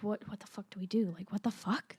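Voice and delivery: whispered, rushed what the fuck; panicked whisper. Retold or imitated. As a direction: hiss it in a hurried whisper. Scared drunk boys in garage.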